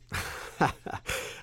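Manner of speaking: a soft breathy laugh, eager to please; fawning chuckle. Acted. Curator leads the benefactors away, soft fawning chuckle. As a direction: laugh along with the rich guests. Leading rich benefactors downstairs.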